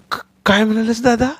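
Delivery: put-on high, stammering little boy; little brother startled by the remark; startled small child. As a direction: ask in shocked surprise.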